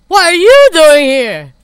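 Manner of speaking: drawn-out booming demand, stress on you; loud slowed voiced line; slow, loud character voice.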